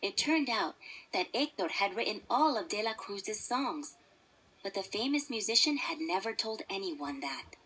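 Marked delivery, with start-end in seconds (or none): none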